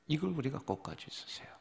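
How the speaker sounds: fast urgent whisper. Real, not acted. Preacher telling the flock to hold on, whispered. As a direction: hush it like a secret, quickly.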